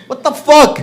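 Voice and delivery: loud, high burst of disbelief; incredulous outburst. Acted. Comedian rejecting his own Igor scenario, incredulous shout. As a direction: explode at the absurd idea.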